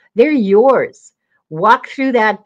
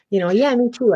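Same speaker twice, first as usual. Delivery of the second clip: usual speaking voice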